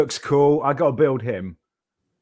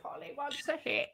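parrot voice; 13 dB quieter than usual. Pretend. Imitating parrot.

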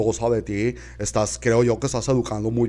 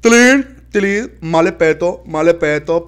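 scolding; 5 semitones higher, 7 dB louder than usual. Acted. Scolding call.

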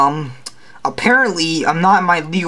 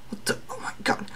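whispering; 13 dB quieter, faster than usual. Acted. Exasperated aside about Spider-Man.

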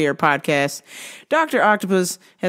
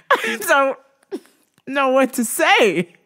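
stifling laughter; 8 semitones higher, slower than usual. Retold or imitated. Stifling laughter at the bad news.

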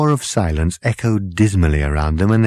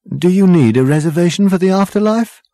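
louder, high incredulous query; baffled question; 11 semitones higher, 6 dB louder than usual. A narrator's reading. Ford questions the waiter about the afterlife, baffled. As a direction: ask in rising bewilderment.

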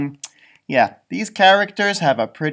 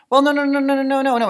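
frantic protest; 9 semitones higher, 3 dB louder, faster than usual. Pretend. Caller protests principal's refusal.

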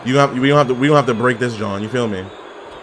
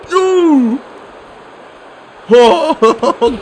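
loud high ooh; 12 semitones higher, 6 dB louder than usual. Real, not acted.

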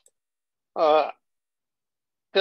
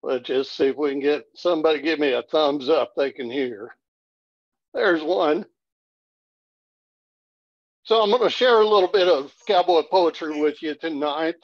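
usual speaking voice

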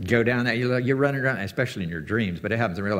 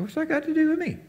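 high, offhand retort; mock high retort. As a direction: toss it back in a high voice. Yeshua voiced to his mother.

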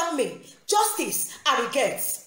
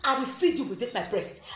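ordinary delivery

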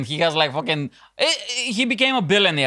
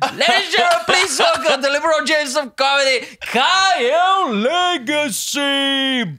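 announcer intro; 5 semitones higher, slower than usual. Acted. Host introduces guest Kyle Legacy to the crowd, booming announcer. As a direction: bellow the guest's name like a ring announcer.

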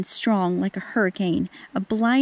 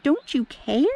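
frightened plea; 4 semitones higher, slower than usual. Acted. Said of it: "they shrieked".

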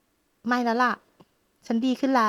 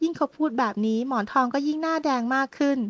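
ordinary delivery, nothing marked

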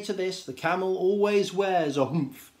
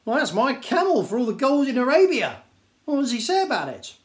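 excited Djinn; 9 semitones higher, 7 dB louder than usual. Acted.

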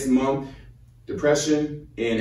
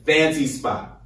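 normal talking voice

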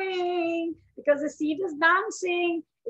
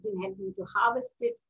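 usual speaking voice

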